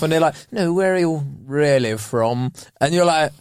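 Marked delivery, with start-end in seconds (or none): 0.5-2.5 put-on slow middle-class English accent; drawn-out posh accent; posh, probing question. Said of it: "a glass of wine like this".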